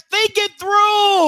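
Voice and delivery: "through" stretched long and high; slow, high-pitched exhortation ending on a long "through"; emphatic plea. Real, not acted. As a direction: draw out "through" as a big warning. Exhorting listeners after the story.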